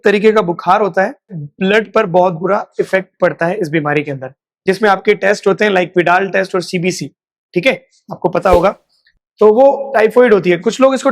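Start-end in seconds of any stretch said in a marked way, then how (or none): none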